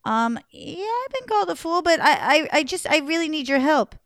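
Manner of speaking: high, stammering plea; nervous prince. Acted.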